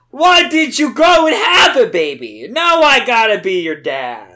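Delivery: loud, high hammed-up yelling; mock yelling at Rouge. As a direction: rant like an outraged teen. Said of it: "He kept yelling at her".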